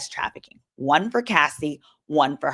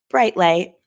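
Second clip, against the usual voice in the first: ordinary talk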